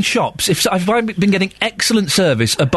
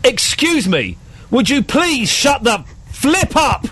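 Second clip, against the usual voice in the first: high-pitched, drawn-out, exasperated public plea; very loud, polite telling-off; 7 semitones higher, slower than usual. Retold or imitated. Man telling noisy girls off in the cinema, loud and polite. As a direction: call it out slowly, politely but very firmly. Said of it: "I said very loudly".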